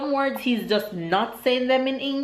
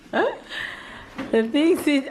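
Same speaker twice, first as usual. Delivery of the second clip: slow words through laughter; laughing; 6 semitones higher, much slower than usual. Real, not acted. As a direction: break into laughter mid-phrase. Laughing mid-sentence.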